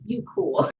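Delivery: normal talking voice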